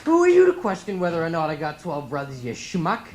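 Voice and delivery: put-on loud Boston-accented outburst; indignant tirade, swelling to a shout through the list; defensive. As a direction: play an offended Boston guy. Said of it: "I get defensive about the lie".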